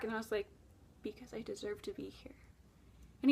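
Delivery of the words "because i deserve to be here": a soft, breathless whisper; hushed surrender. Retold or imitated. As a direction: whisper it, giving up completely. Her defeated answer in dream.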